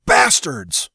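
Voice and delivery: yelled in anger; shout. A narrator's reading. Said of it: "Severn's voice rose".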